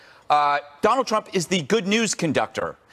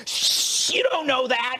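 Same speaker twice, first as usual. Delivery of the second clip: mock media; 8 semitones higher than usual. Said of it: "And then they go".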